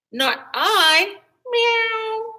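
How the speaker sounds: high cat voice ending in a meow. A narrator's reading. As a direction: mew it like a cat. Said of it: "And the cat said".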